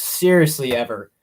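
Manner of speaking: ordinary talk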